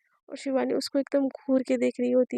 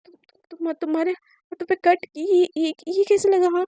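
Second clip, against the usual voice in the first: shocked stammer; 3 semitones higher, 6 dB louder than usual. Acted. Shocked at sister's bleeding cut.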